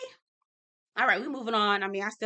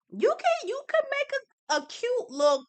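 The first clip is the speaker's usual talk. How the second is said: squeaky high sass; high playful mock voice; high sassy squeal. Sassy aside about profit.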